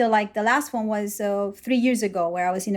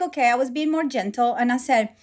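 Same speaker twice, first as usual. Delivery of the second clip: usual speaking voice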